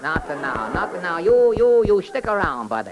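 sing-song comic detective character. Acted. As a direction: play the bumbling detective, chirpy. Detective questions suspects.

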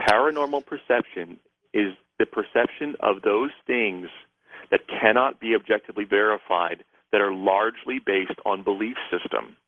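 slowed down, measured definition. Shown as a demonstration. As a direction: repeat it slowly, phrase by phrase. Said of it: "just a little slower".

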